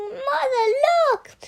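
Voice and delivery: put-on boy bursting in calling his mother; excited call. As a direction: call out eagerly across the house.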